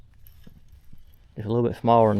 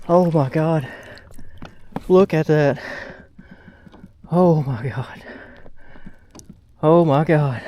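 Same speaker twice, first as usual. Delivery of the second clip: excited shouting; 5 semitones higher, 3 dB louder, slower than usual. Real, not acted. Landing the big bass.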